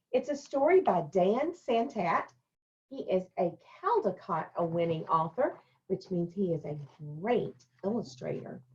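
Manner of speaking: ordinary delivery, nothing marked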